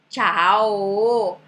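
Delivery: "tchau" loud and drawn out, ending on "oh". Shown as a demonstration. Teacher showing what Italians understand, "tchau" with a loud final O. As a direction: stretch "tchau" to a full final O, chah-oh. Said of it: "com O no final".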